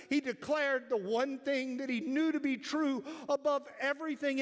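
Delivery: high, loud preaching climax; preaching at full force. Acted. Preacher exalting Jesus to his congregation, fervent. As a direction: lift the church up, full voice.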